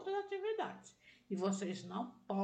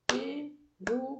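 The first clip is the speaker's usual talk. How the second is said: syllable by syllable; 5 semitones higher, 4 dB louder than usual. Shown as a demonstration.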